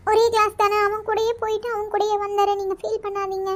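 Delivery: soft, consoling voice, slightly high; gentle reassurance. Acted. Girl consoling her friend's crying mother, gentle reassurance. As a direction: comfort her very softly.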